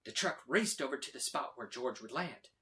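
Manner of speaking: usual speaking voice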